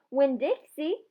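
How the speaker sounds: calling the dog's name out from the door; hollering a name. Acted. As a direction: holler it across the yard. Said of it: "I hollered".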